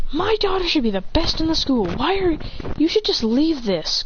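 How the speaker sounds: high, indignant mother's voice; voicing an upset mother scolding the boy; angry mother. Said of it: "as the angry mother says".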